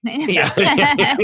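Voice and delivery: high burst of laughter; laughing. Real, not acted. Laughing at the bit.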